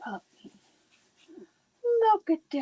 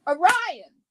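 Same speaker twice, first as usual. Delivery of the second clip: sharp call, louder and higher; calling the dog; 5 semitones higher, 7 dB louder than usual. Real, not acted. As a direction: call the dog's name across the room.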